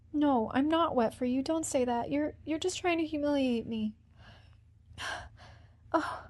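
ordinary talk

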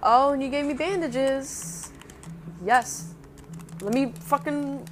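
ordinary delivery

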